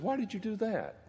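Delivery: puzzled. Retold or imitated. Patient puzzled by the ash cross.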